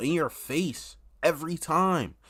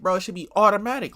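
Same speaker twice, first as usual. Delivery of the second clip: emphatic rant; 8 semitones higher, 6 dB louder, faster than usual. Real, not acted. Ranting about bad game mechanics.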